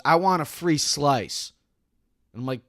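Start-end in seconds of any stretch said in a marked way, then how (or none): 0.0-1.5 demanding customer. Retold or imitated. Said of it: "she goes".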